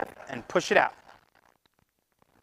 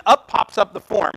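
ordinary talk, nothing marked

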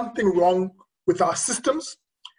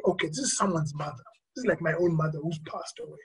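soft, slightly lowered, reflective; hushed inner thought; 3 semitones lower, 9 dB quieter than usual. Retold or imitated. Doctor thinking over a dead patient like their mother, hushed. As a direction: say it quietly, as if to yourself.

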